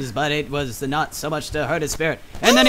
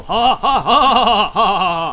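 villain laugh; 3 semitones higher, 6 dB louder than usual. Pretend.

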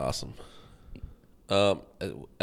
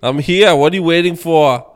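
put-on high, shouted Austrian-accent taunt; Arnold Schwarzenegger; 10 semitones higher, 14 dB louder than usual. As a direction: yell it like a mock Austrian action hero. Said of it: "like an Arnold Schwarzenegger impression".

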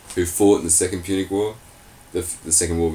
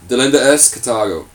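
Cato's declaration; 4 semitones higher, 6 dB louder than usual. Shown as a demonstration. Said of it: "As I said it".

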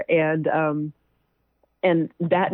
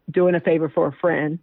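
ordinary talk

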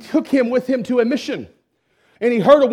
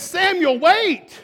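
calling after someone; 9 semitones higher, much slower than usual. Retold or imitated. Retold calling after running man.

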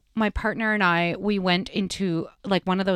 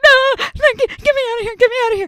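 very high, loud frantic begging; panicked pleading; 7 dB louder, faster than usual. Retold or imitated. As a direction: scream to get out. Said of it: "And I'm like".

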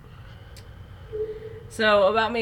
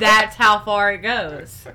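laughing while talking; 8 dB louder, slower than usual. Real, not acted. Laughing at the joke.

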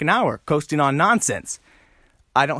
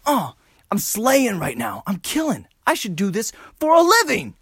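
mock elated; 8 semitones higher than usual. Comedian thrilled his joke landed, mock elated. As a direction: gush in a put-on high voice.

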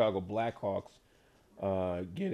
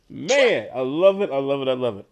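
thrilled; 2 semitones higher, 9 dB louder than usual. Real, not acted. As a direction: relish the answer out loud. Host thrilled after laughing.

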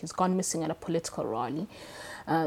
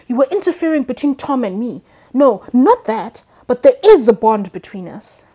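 higher and louder, slowing on "No, not that"; heated reading; 5 semitones higher, 12 dB louder than usual. Acted. Tom as Dickie scolding Marge at the mirror, louder and higher.

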